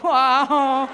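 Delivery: mock drawn-out, loud wow; imitated audience member. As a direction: mimic someone overdoing the wow. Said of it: "some of you are like".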